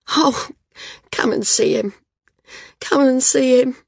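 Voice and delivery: high, tearful urging; through tears. A narrator's reading. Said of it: "kept saying through her tears".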